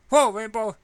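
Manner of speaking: loud high startled cry; yelped in alarm, high and loud; startled whoa. Acted. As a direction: jump at the sudden shock. Startled by door slamming.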